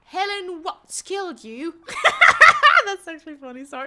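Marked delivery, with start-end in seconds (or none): 1.8-2.8 loud, high laugh; high laugh burst. Real, not acted. Laughing that Helen Watts killed a teammate, high laugh.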